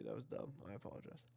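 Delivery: very soft, breathy aside with the last words whispered; sheepish whispered sorry; quiet apology. Real, not acted. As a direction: mumble the apology almost to yourself. Embarrassed aside after a mistake.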